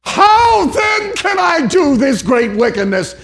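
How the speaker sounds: high, loud, slow pulpit shout; preaching shout. Acted. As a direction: cry it out at the climax, slow and high. Preacher's climax cry.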